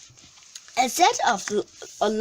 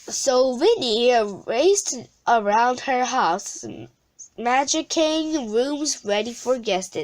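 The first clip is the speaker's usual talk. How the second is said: plain reading voice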